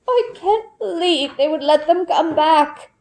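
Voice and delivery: put-on an old woman fretting under her breath; worried elderly lady, strained. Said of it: "Her voice sounds strained".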